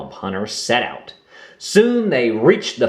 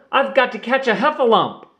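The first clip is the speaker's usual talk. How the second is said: put-on young Roo; 12 semitones higher, faster than usual. A narrator's reading. Roo insists.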